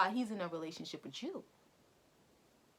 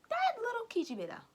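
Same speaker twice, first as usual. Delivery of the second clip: mocking sneer; 14 semitones higher than usual. Real, not acted. Insulting the girl.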